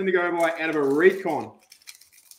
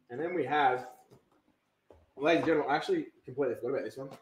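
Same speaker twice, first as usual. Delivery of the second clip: ordinary talk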